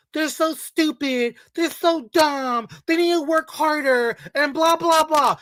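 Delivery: a lightly voiced mock complaint; mocking women. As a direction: parrot them with a mocking lilt. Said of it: "they always criticize the men".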